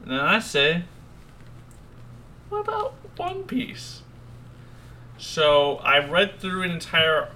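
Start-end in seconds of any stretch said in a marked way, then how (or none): 2.5-4.0 very high, soft squeak; put-on falsetto, softly; tiny high voice. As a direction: squeak it out small and quiet. Acted quote of own suggestion.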